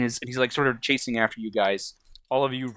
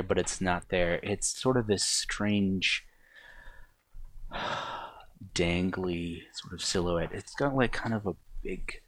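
eerie scene setting; 3 semitones lower, 5 dB quieter than usual. Acted. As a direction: trail into an ominous near-whisper. Game master's eerie scene setting.

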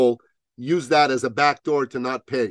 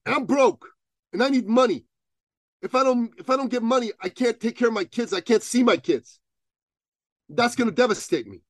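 mock pleading client; 9 semitones higher than usual. Client pleading about money.

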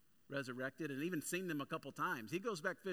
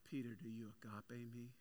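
Jesus gently pressing Peter, soft and lower; tender question; 3 semitones lower, 7 dB quieter than usual. Retold or imitated. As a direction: ask it gently, close and low. Jesus asks a third time.

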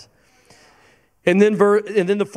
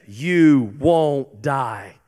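slow serpent lie; 4 semitones lower, much slower than usual. Acted. Serpent's reassurance quoted.

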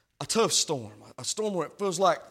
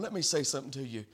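usual speaking voice

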